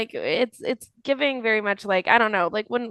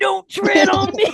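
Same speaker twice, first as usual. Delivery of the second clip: much higher, slow hammed-up yell; mock patriot shout; 11 semitones higher, 5 dB louder than usual. Parodying Florida locals at protesters, mock rally shout. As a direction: bellow it like a rally chant.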